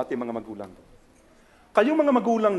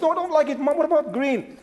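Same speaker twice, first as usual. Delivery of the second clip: put-on high-pitched kid refusing; pitched-up child voice; imitating a child; 9 semitones higher than usual. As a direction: whine back like a picky child. Child refuses mother's color.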